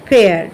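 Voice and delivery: ফেয়ার with e glide, falling low; E-vowel pronunciation. Shown as a demonstration. Teacher demonstrates the e sound, ফেয়ার falling as fay-ar. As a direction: say ফেয়ার as fay-ar, pitch dropping. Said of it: "উচ্চারণটা হচ্ছে একারের মতো".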